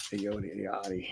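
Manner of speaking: unvoiced whisper, practicing the name; soft whispered rehearsal of a name; whispered to self. Real, not acted. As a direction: whisper the name over to yourself. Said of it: "A Y O A D E".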